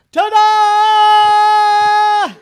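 high, very loud, long-held ta-da; top-of-lungs yell. A narrator's reading. Child announces brushed teeth to the house, full yell. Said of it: "she yelled at the top of her lungs".

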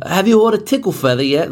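high, quick British question; mock British accent. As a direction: ask it in a put-on British accent. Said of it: "buzzwords for them".

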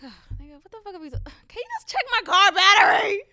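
rising to an incredulous shout; imagined fed-up outburst; exasperated inner retort. Retold or imitated. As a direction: build from hushed disbelief to a loud fed-up shout. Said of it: "That's me in my head".

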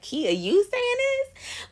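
high, slow mock disbelief; incredulous friend. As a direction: play the doubting friend who can't believe it. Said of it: "people hearing this is like".

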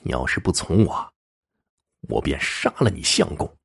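quiet, controlled menace; threatening. A narrator's reading. Henchman beside the husband threatens the woman, quiet menace. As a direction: threaten softly, holding the voice down. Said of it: "威胁道".

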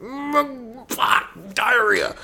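high, strained string of curses; hammed-up hurt yelps, one word at a time; mock pain swearing. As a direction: imitate someone swearing through pain. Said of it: "all make different yells when we fall and hurt ourselves".

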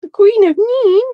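high, loud indignant echo; mock outrage. Real, not acted. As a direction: squeal it back in disbelief. Guest echoes her title in mock outrage.

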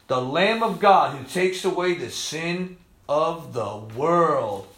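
slow, soft murmur of wonder; awed repeat. Real, not acted. Preacher marvelling at the verse, slow awed murmur. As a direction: savour each word quietly.

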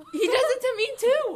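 high, loud squeal; excited outburst. Real, not acted. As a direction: burst in loudly with shared indignation. Excited interruption about the brother.

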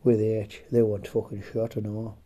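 ordinary talk, nothing marked

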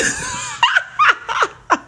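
a big high burst of laughter. Real, not acted. Cracks up at own story.

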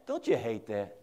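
usual speaking voice

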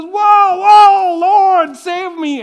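very high, very loud mock panic; panicked cry. Peter sinking in the water, panicked cry for help. Peter voiced drowning, crying out.

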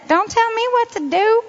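a hammed-up snippy reply; defiant retort. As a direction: toss it back like a defiant teen. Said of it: "I could have said".

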